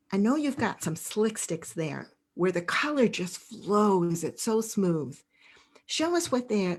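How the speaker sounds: ordinary talk, nothing marked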